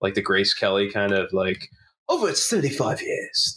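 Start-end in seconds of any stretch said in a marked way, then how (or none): 2.1-3.5 Mid-Atlantic accent. Shown as a demonstration. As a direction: put on a Grace Kelly Mid-Atlantic lilt. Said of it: "affect a Mid-Atlantic accent".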